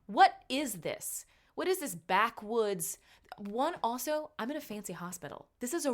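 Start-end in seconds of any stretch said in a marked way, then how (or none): 0.0-3.0 slow, loud, incredulous demand; appalled disbelief. Retold or imitated. Patient complaining about a fancy hospital, slow appalled disbelief. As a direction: stretch out each outraged question. Acted outrage at holding own blood.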